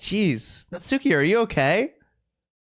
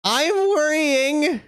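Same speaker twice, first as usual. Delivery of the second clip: drawn-out worrying, much higher and slow; whiny drawn-out fret; slow, high mock worry; 9 semitones higher, 4 dB louder, much slower than usual.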